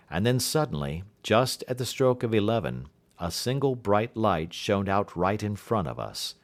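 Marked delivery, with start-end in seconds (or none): none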